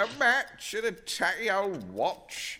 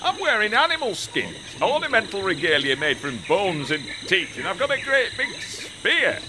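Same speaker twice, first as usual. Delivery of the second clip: ordinary talk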